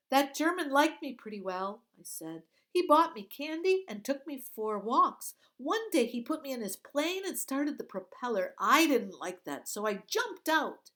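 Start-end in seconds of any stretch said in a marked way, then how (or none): 0.1-1.9 quick, high, loud chatter; talking faster. Acted. Girl stalls the guards, chattering fast and loud. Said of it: "I started talking faster". 2.7-10.9 quick, high, loud chatter; talking faster. Acted. Girl stalls the guards, chattering fast and loud. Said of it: "I knew I was talking too fast".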